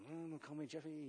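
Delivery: very soft, under-the-breath protest; retold grumble. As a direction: imitate a teen grumbling to himself. Retold teenage grumble at mom.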